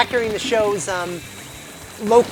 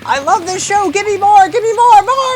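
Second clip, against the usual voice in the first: shrill escalating shouts for more; frantic caller; 12 semitones higher, 8 dB louder than usual. Retold or imitated. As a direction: build to a manic high yell. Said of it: "left a message saying".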